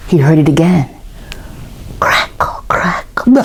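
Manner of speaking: hushed suspense, building to a gasp; spooky story narration. A narrator's reading. Storyteller builds suspense for children, hushed and creepy. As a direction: draw the children into the fear.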